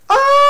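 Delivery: very high, very loud hammed-up shriek; imitated shriek. Reviewer reenacting the villain's shriek. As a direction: let out a wild campy scream. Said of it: "he literally, without word of a lie, does this".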